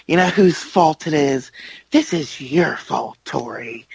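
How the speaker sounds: a hint of a menacing soap villain; accusing stepmother. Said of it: "she looks at Tori and goes".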